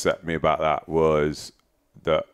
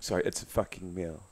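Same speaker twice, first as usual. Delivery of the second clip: much softer, sheepish; quieter retake; 12 dB quieter than usual. Real, not acted. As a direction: say it again quietly, sorry.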